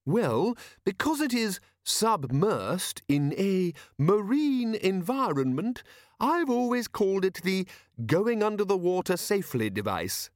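high, slow, halting explanation; earnest eccentric inventor. A narrator's reading. Inventor names his device for the sergeant, earnest. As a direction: search for each word of the name. Narrator voices the inventor Leonard.

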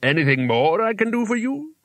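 high, lilting creature voice; conversational. A narrator's reading. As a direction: pitch it up into an obliging question.

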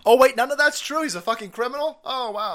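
high-pitched fake astonishment; mock surprise. Host discovering none of it's true, mock surprise. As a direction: act shocked with heavy sarcasm. Feigns discovering the praise is false.

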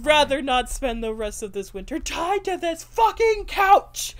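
mock exasperation swelling to a yell; imitated rant exploding at the end; building outburst. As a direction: lose composure, ending high and loud. Repeats the acted outburst.

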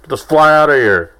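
loud, high exasperated rant at a fly; acted-out outburst. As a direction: yell it like you're swatting furiously. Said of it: "acting it out, like".

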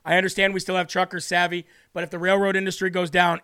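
ordinary talk, nothing marked

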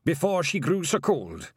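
lightly voiced old Scottish Feagle, slow and grave; a hint of a sorrowful old Feagle elder; old Feagle William. A narrator's reading.

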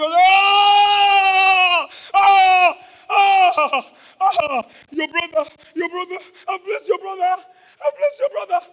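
put-on high, loud anguished cries of an old man; Isaac crying out. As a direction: wail in shock that he blessed the wrong son. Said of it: "The old man shook".

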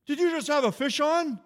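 high, loud eager question; retold yell. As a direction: imitate a fisherman calling over. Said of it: "someone yells".

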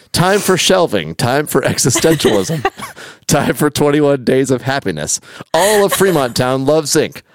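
high, upbeat lyric recital; hammed-up cheery verse; verse recitation. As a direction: recite it brightly, each phrase lifting then falling.

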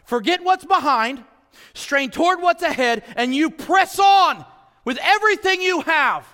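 high, loud rising exhortation; preaching at full force. Real, not acted. Preaching climax to congregation.

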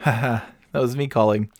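ordinary talk, nothing marked

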